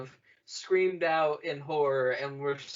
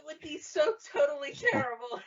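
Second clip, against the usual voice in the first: mock melodrama; 11 semitones higher than usual. Mock Rey fake distress.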